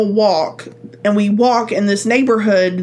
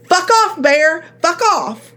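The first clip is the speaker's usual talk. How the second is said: scaring off a bear; 7 semitones higher, slower than usual. Pretend. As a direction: shout the bear away. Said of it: "I would say".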